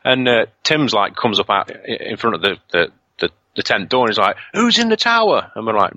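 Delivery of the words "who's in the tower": high-pitched, alarmed questioning; agitated demand. Retold or imitated. Friend Tim questions the campers at the tent, agitated demand. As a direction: imitate a rattled friend, voice jumping up.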